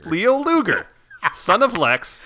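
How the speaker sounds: high, loud announcement; grand name reveal. Pretend. Trainer renaming Adam Rose, high loud reveal. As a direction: proclaim the new ring name.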